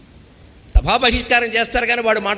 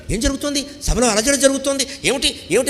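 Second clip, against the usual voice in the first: anxious blind king; 7 semitones higher than usual. Retold or imitated. Blind king asking in court.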